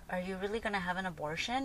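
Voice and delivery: soft, subdued questioning; hushed retold confrontation; changed tone. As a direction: ask quietly, imitating a disapproving mom. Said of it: "her whole tone was different".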